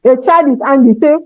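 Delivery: ordinary talk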